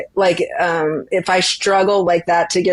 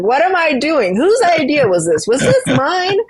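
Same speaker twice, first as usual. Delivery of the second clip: much higher, laughing through mock despair; 9 semitones higher than usual. Runner retelling her mid-race regret, laughing mock despair. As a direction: wail it in half-laughing disbelief.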